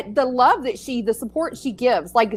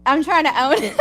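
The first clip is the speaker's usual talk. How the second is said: laughing; 4 dB louder than usual. Real, not acted. Laughing at own joke.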